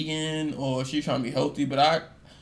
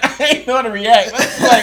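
laughing; 7 semitones higher, 10 dB louder than usual. Real, not acted. Laughing while reliving story.

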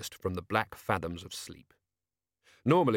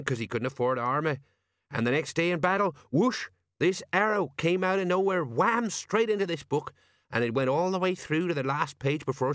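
higher, animated sergeant voice; storytelling old soldier; 5 semitones higher than usual. Acted. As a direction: spin a battle yarn like a veteran.

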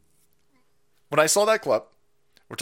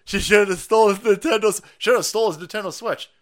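mock lefty voice; 5 semitones higher, 6 dB louder than usual. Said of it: "the lefty's like".

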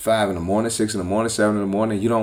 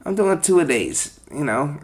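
mocking the client; 9 semitones higher than usual. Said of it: "She's like".

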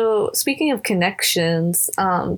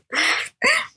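very high giggle; nervous laugh. Real, not acted. Nervous about being recorded.

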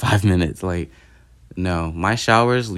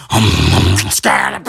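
video voice; 7 dB louder, slower than usual. Retold or imitated. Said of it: "I was like".

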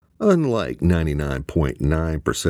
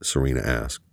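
ordinary delivery, nothing marked

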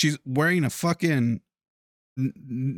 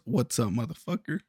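quieter, lower seductive murmur; a hint of a stripper's husky come-on; soft in-ear murmur; 6 semitones lower, 6 dB quieter than usual. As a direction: purr it right into his ear. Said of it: "Right in your ear".

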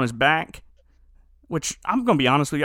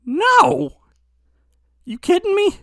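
high, loud refusal; high outraged no; incredulous yell; 5 dB louder, slower than usual. Retold or imitated. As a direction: shout it like he can't believe it. Henry refusing to return.

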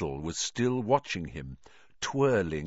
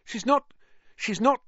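panicked stammer; 14 semitones higher, 4 dB louder than usual. Acted. Harry protesting, panicked.

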